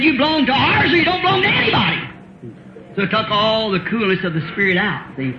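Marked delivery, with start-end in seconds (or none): 0.0-2.0 mock domineering demand.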